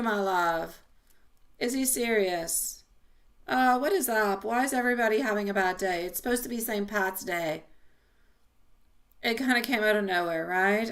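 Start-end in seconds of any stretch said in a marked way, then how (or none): none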